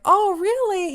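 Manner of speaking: high, drawn-out imitated surprise; Greg Miller's pleased surprise. Star hearing she's a fan, pleased surprise. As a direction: lift it high, as if delighted to hear it.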